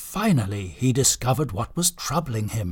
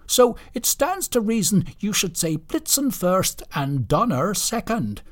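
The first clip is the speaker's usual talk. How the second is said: lightly voiced indignant reindeer, high-pitched; high, insistent character voice; Blitzen; 7 semitones higher than usual. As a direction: argue the point as an aggrieved reindeer.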